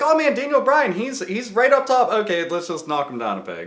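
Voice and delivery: high, loud mocking executive; mock the bosses in a high voice. Mocking WWE creative.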